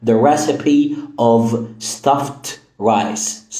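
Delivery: The recipe of stuffed rice, very slow; slow example. Shown as a demonstration. Teacher reading the example slowly. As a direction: draw out the example sentence. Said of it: "مثلًا وصفة المحشي".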